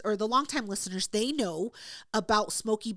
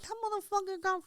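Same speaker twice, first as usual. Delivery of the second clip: higher and much softer, under the breath; hushed aside; 7 semitones higher, 5 dB quieter than usual. Retold or imitated. Retelling a secret remark about Shane's son, soft and hushed. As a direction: confide it so Shane can't hear. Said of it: "And I was like".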